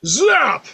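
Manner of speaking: sharp high loud zap; zap sound. Retold or imitated. GM voicing the lightning bolt, sharp high zap. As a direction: spit out the bolt's crack.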